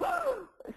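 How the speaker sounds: a high, startled intake of breath; retold gasp of shock; sharp gasp. Reacting to husband's no.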